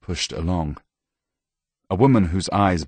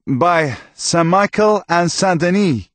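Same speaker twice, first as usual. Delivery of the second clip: higher, slow, solemn priest voice; slow, higher cleric leading a vow; priest dictating oath; 12 semitones higher, 6 dB louder than usual. Acted. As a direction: intone the oath for him to repeat.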